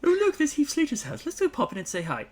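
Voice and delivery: high, loud, rapid-fire enthusiasm; hammed-up delight, rushed out at a high pitch; mock excited buddy. Acting out a road trip buddy.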